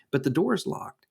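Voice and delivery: ordinary talk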